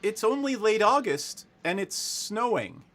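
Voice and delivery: high, emphatic protest; incredulous deputy. Acted. Deputy explains the cold to visitors, high and incredulous. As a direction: point out the obvious, pitched high.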